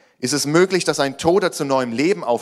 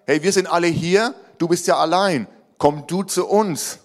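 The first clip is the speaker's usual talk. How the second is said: higher-pitched friendly call to join; calling someone over; 7 semitones higher, 4 dB louder than usual. Acted. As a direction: call over to a lone friend, lightly acted. Disciples imagined calling Peter over.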